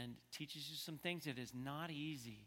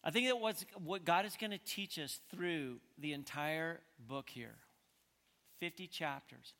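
ordinary delivery

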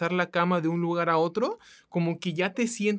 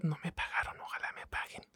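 whispering under the breath; a secret little aside, barely voiced; whispered aside; 2 semitones lower, 14 dB quieter than usual. Real, not acted. Aside about unpaid advertising.

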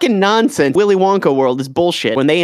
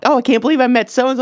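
quick, higher-pitched mock gushing; mock starstruck fan; 7 semitones higher, faster than usual. Fan bragging about meeting someone famous, mock starstruck. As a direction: imitate a gushing fan, fast and high.

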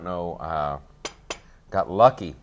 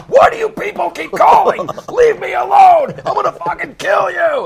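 shrieking, much higher and louder; screaming rage; 12 dB louder than usual. Retold or imitated. Furious man yelling at a window telemarketer, screaming rage. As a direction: scream it like he's lost all control. Angry customer screaming on the phone.

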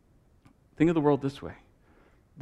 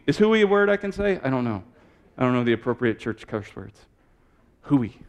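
ordinary delivery

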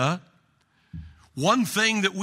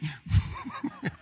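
laughing; 8 semitones higher, 7 dB quieter than usual. Real, not acted. Laughs after the joke.

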